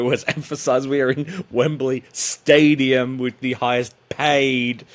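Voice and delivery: slow mock stress on "highest paid"; mock promo emphasis. Guest mocking the promoter's boasting, drawn-out stress on "paid". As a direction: spoof the hype, drag out "highest paid". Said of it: "you must emphasize the word paid".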